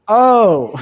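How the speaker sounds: loud, drawn-out "oh"; retold realization. Nine-year-old self recognizing Mitt Romney on TV, retold realization. Acts out his sudden realization.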